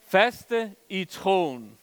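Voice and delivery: drawn out word by word, "Faste" leaned on; preacher hammering the point slowly; very slow emphasis. Shown as a demonstration. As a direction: stretch "Faste i troen" out very slowly.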